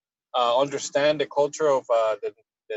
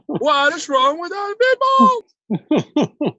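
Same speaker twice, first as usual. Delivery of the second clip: much higher and louder, incredulous cry; laughing disbelief; 6 dB louder than usual. Real, not acted. Reacting to the Seinfeld game with laughing disbelief. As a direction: exclaim in amused shock.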